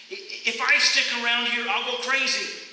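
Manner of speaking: high, loud, fed-up outburst; desperate cry. Retold or imitated. Runner crying out before fleeing, loud and desperate. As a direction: burst out at the end of your rope. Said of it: "I cried and I started running".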